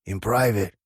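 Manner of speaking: demanded. A narrator's reading. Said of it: "Dodge demanded".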